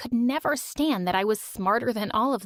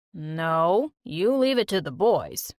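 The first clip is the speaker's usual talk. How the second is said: lower, dismissive father; a lower father voice refusing his daughter; stern dad; 6 semitones lower, slower than usual. Acted. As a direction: brush the girl off firmly.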